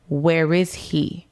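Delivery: drawn out, very slow; slow retold repeat. Wife asks the caller where her husband is, slowly. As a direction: ask it again, each word dragged out.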